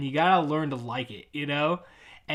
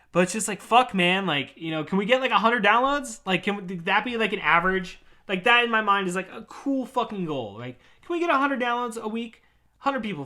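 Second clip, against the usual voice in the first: higher, louder pleading; exasperated plea; 6 semitones higher, 5 dB louder than usual. Real, not acted. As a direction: beg for growth in frustration.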